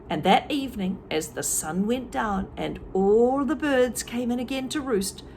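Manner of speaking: ordinary delivery, nothing marked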